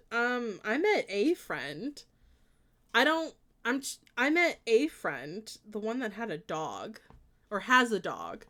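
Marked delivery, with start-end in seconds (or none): 0.6-2.0 slow, with "a" said as a full, stressed "ay". Real, not acted. Answering whether she met the friend, pointed stress on "a". 4.2-5.5 "a" stretched into an emphatic "ay"; slow down and punch "a" as "ay"; stress on "a". Real, not acted. Insisting on which friend she met, pointed stress on "a".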